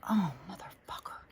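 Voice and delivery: hushed whispered swear; whispered curse. Retold or imitated. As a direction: mutter it under your breath. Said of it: "I was like".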